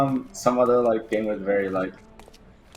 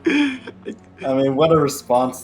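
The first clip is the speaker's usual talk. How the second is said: laughing; 5 semitones higher, 7 dB louder, slower than usual. Real, not acted. Laughing at game character's reaction.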